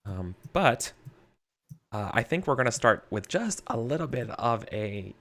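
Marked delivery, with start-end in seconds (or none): none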